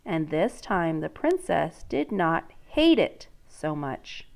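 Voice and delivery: reading a picture book to a class; storybook read-aloud voice; story narration. Acted. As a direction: let the princess's dread come through. Teacher reads story aloud.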